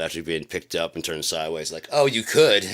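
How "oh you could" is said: mock taunt. Mocking the guy who threw him.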